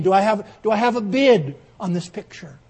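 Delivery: loud auctioneer asking for a bid; calling out bids. Acted. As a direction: call to the back of the room.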